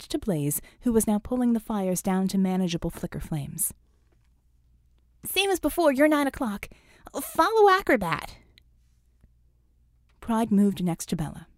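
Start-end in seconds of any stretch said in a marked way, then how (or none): 5.2-8.4 higher, louder call back. A narrator's reading. Scope to Bella across the lot, higher louder call.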